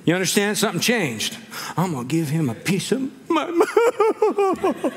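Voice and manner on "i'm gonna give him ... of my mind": bold words dissolving into high mock wailing; crumbling into tears. Mary loses her nerve before Jesus, mock weeping. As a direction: start firm, then slow into a hammed-up bawl.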